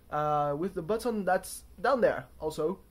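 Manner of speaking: loud, hammy mock British accent; bad British accent. Pointing viewers to the button in a bad British accent. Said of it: "a terrible impression of a British accent".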